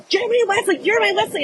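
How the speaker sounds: high, urgent mock calling of names; retold scream. Screaming to Dave about the boys, mock-frantic high call. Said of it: "So I start screaming to Dave".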